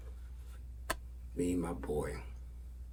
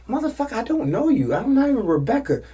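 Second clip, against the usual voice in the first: high, loud mock outrage; imitated indignant retort; 10 dB louder than usual. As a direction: yell back as an offended woman. Woman snapping back in acted quarrel.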